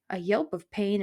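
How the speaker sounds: ordinary delivery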